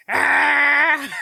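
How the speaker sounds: high sudden yelp; scream. Retold or imitated. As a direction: shriek as she's grabbed. Girl grabbed by pigtails.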